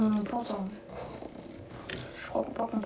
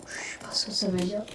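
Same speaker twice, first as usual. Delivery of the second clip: whispered aside; 3 semitones lower, 6 dB quieter than usual. Real, not acted. Muttering confused aside.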